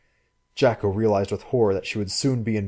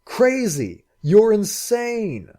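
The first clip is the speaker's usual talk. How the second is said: much higher, slow furious yell; furious shouting; 12 semitones higher, 5 dB louder, much slower than usual. Acted. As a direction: hurl the words at her in rage. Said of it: "repeating furiously".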